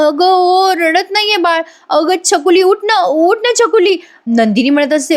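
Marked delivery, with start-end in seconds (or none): 0.1-4.0 high-pitched, urgent plea; retold anxious pleading. New mother pleads with her silent newborn, anxious. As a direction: beg the baby to wake, voice climbing.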